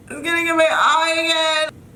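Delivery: high wail, stretched on EYE; distressed wail. Real, not acted. Cutter with hair falling in the eye, high distressed wail. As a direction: wail in real discomfort.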